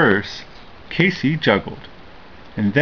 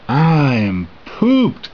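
very slow, drawn-out tired whine; exhausted while scrubbing dishes; whining; 4 semitones higher, much slower than usual. A narrator's reading. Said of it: "Casey whined".

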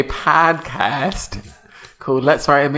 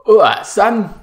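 loud, higher gruff London dad greeting; cockney dad; 8 semitones higher, 7 dB louder, slower than usual. Acted. Dad answering his son's call, in a gruff cockney voice. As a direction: play the rough East End father. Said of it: "should I be the dad".

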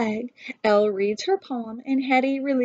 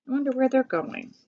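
usual speaking voice

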